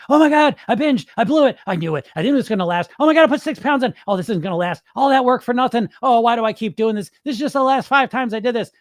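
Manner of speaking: high, loud spiralling wail; mock despair. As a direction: act out a dieter's frantic panic spiral. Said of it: "You get so fixated on the binge".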